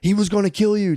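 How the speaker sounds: mild mock yell at the wife; mock scream. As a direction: imitate her screaming the warning. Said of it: "she starts screaming".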